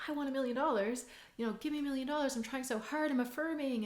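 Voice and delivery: high, hurried mock pleading; frantic pleading. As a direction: act out someone trying far too hard. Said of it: "I'm frantic".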